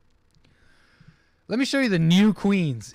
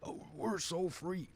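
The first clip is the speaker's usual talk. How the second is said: mocking smug Americans; 3 semitones higher, 13 dB quieter, slower than usual. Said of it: "There's no, uh".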